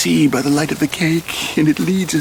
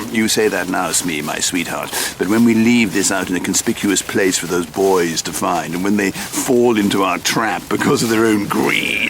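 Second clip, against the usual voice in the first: low, scheming villain voice; menacing gloating; 10 semitones lower than usual. Acted. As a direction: savour the wicked plan in low tones. Villain gloats over his trap.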